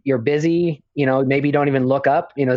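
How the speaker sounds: ordinary talk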